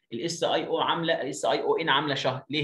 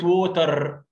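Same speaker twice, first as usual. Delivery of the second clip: water in a mock Egyptian accent; 4 semitones higher, 6 dB louder than usual. Shown as a demonstration. Teacher mocking the wrong Egyptian water. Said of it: "ده مصري ما ينفعش خالص".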